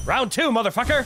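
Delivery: high, loud challenge; psyched up to fight; hyped battle cry. Real, not acted. Boss fight restarts.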